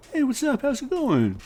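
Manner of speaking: high, forced-cheery hello; fake friendly greeting. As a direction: pitch it way up, awkwardly polite. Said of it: "we'll maybe be like".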